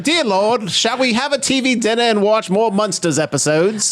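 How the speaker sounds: high posh British accent, mocking. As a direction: put on a snooty British accent. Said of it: "why you had a fucking British accent".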